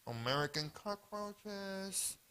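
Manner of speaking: lightly voiced pest control guy. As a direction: sound like a slow know-it-all exterminator. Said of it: "pest control guy came in and said".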